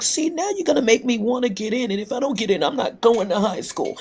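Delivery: high-pitched mock protest; protesting schoolgirl. Pupil objecting to teacher's audition push, high protesting voice.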